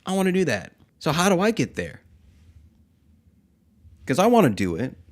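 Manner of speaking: put-on high pleading childish whine; whiny kid. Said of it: "I sound like a whiny kid".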